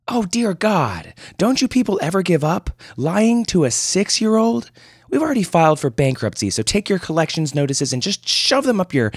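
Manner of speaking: furious mother on the phone; angry mother. Acted. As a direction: tear into the debt collector. Mother berates debt collector.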